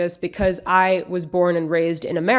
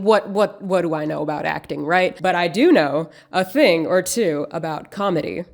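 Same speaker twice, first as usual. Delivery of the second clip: ordinary talk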